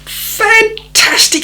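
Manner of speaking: very high, slow, drawn-out delight. Retold or imitated. City chap thrilled about the party, high delighted exclamation. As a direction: stretch it out in gleeful excitement.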